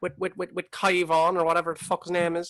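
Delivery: gossiping Cork local. Acted. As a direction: play a nosy Cork local spreading gossip.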